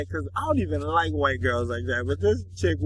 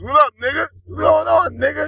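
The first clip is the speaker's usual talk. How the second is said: mock greeting; 8 semitones higher than usual. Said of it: "we calling ourselves".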